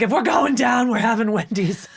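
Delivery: loud, mock-heroic declaration; defiant joke. On the drive alone, declaring her last meal loudly. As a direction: proclaim it like a last stand.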